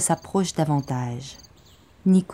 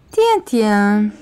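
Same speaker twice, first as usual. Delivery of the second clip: mocking repeat; 4 semitones higher, 5 dB louder than usual. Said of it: "Il répète".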